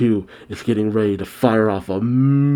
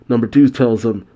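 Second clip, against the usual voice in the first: usual speaking voice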